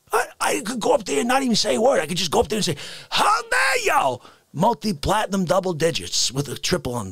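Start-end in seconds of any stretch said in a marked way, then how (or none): 3.1-4.2 high, slow indignant wail; high slow yelp. Acted. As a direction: stretch it out like a diva.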